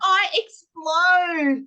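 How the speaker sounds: put-on high, loud, slowly stretched kid answer; voicing kids. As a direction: call it out like an excited child. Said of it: "They go".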